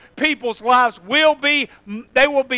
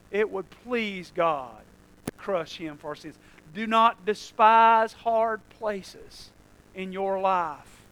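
much softer, slow and low; hushed preaching; 3 semitones lower, 9 dB quieter, slower than usual. Acted. Preacher softly urging the congregation. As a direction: lean in and speak gently.

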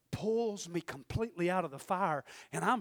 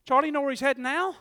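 ordinary talk

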